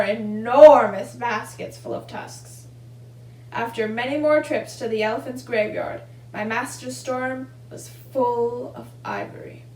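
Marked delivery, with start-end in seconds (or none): none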